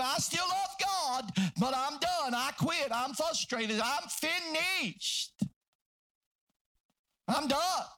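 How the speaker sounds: hammed-up exasperation, stretching the final words; frustrated quitting. Acts out frustrated member quitting.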